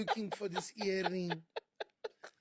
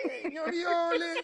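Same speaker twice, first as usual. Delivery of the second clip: eager fan greeting; 11 semitones higher, 10 dB louder than usual. Retold or imitated. Fan greets him again.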